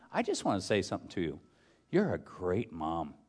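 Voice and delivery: soft, low, heartfelt retelling; quiet, tender sincerity; tender praise. Retold or imitated. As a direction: gently voice a sincere compliment. Voicing wife tenderly praising a mother.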